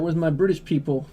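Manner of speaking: ordinary talk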